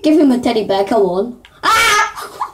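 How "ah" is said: sudden high yelp; startled yelp. Real, not acted. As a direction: jump in fright. Startled in game.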